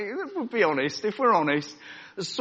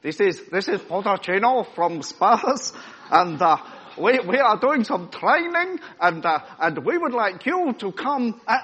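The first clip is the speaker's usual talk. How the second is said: higher, louder put-on Spurs manager voice; hammed-up football manager on the phone; Pochettino impression; 5 semitones higher, 5 dB louder than usual. Spurs manager phoning Oz with an invitation, hammed-up put-on voice. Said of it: "I can't do his accent".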